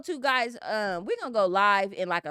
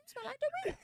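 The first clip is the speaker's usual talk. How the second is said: mock sniffing delight; 12 dB quieter, much faster than usual. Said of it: "and I'm like".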